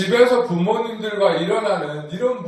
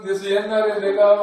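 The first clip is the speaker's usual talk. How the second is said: normal talking voice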